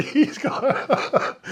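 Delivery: breaking into laughter mid-phrase; laughing. Real, not acted. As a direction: laugh through the words.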